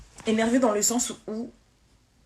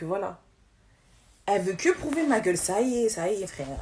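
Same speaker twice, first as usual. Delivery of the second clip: usual speaking voice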